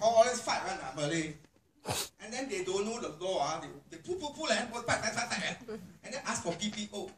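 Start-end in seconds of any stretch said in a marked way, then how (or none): 0.0-1.3 loud, offhand officer voice; mock police officer. Police officer dismissing a familiar domestic fight, loud. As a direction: shrug it off like a bored cop. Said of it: "Tanyalah polis officer".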